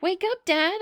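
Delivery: high, loud plea to wake dad; child shaking her sleeping dad awake; calling out. A narrator's reading. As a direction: call him awake. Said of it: "I cried".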